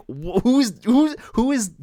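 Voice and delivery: high, loud, stammering incredulity; exasperated disbelief. Retold or imitated. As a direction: retell your baffled outrage, pitch shooting up. Retold disbelief at bad accents.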